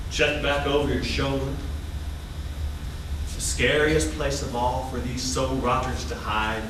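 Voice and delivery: low-pitched, spooky warning; ominous monologue. Acted. Warning a theater audience of evil hiding inside, ominously.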